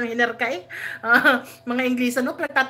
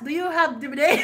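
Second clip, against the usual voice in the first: normal talking voice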